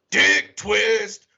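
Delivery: high, loud punchline call-out; big high-pitched exclamation; gleeful shout. Retold or imitated. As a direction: belt out the punchline.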